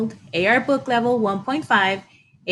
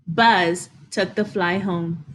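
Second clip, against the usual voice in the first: usual speaking voice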